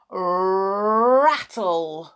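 long rolled r, rising; rattle effect. A narrator's reading. Wheelchair speeding backwards, rattling sound.

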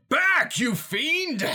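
high, loud hammed-up shout; battle cry. Paladin strikes the ghost, hammed-up battle cry. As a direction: roar it at a monster as you strike.

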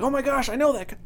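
excited recognition. Retold or imitated. Retelling spotting the high school teacher on screen, excited recognition. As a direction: replay the thrill of recognizing someone.

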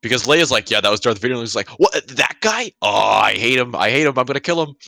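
usual speaking voice

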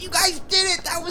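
very high, squealing celebration; overjoyed, tearful high voice; crying with joy. Acted. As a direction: gush through happy mock tears.

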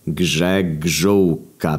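"Grzegżółka" spelled out very slowly, syllable by syllable; slow relaying. Acted. Officer relaying the surname to Marian, very slow syllables. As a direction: drag out "Grzegżółka" in your own pitch.

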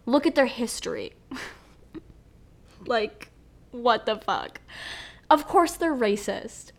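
normal talking voice

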